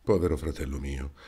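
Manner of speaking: quietly crushed, sincere grief; understated sorrow for his brother; heartbroken sincerity. Acted. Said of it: "con tale schianto di sincerità".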